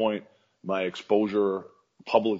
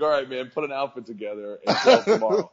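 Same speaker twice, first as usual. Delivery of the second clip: a touch higher, amused; lightly voiced booker; 6 semitones higher, 3 dB louder than usual. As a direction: booker telling him to show up, lightly voiced.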